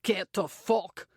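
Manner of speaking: slow, raspy Scottish snarl; Scottish growl. A narrator's reading. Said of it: "the man growls".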